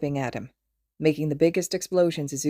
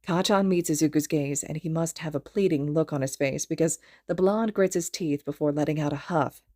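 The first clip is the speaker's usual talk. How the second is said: ordinary delivery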